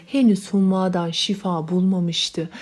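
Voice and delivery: ordinary delivery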